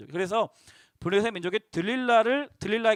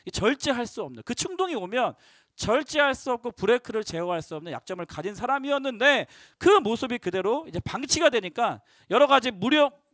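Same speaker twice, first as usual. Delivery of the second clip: ordinary delivery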